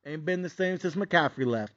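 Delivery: usual speaking voice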